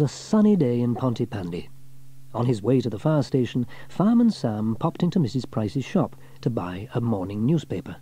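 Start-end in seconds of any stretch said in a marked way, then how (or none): none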